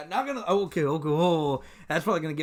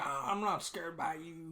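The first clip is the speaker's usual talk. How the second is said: slow, softer taunting imitation; mock unimpressed neighbor; 8 dB quieter, slower than usual. Friend mimics neighbor hearing about cameras, mock unimpressed. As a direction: play at shrugging off a threat.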